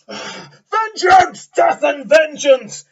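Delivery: high, very loud battle roar; war shout. Acted. Dragonborn barbarian charging the party, roaring for vengeance. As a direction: bellow it while charging with the sword. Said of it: "and she's there going".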